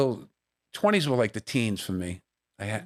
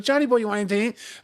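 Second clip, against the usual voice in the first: higher, fast, fussing mom; doting mother; 12 semitones higher, 3 dB louder, much faster than usual. Retold or imitated. Mother offering food, retold.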